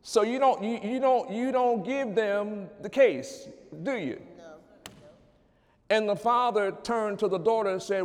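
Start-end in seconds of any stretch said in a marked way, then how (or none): 0.0-4.2 higher and louder, pressing the point home; preaching at full force. Acted. Preacher driving the lesson to the church, preaching loud. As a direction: hammer it to the congregation like a preacher.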